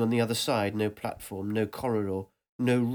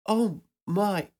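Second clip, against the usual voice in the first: a high, breathy "Oh my" of wonder; awed gasp; 8 semitones higher than usual. Acted. Narrator stunned by the crowd in the darkness, high and amazed. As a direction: struck with amazement.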